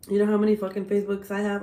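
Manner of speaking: ordinary delivery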